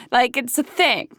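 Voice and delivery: ordinary talk, nothing marked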